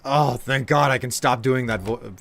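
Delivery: ordinary talk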